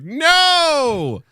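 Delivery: high, loud mock "No!"; mock wail. Joking about reacting to the layoff, mock wail. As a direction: wail it like a hammed-up protest.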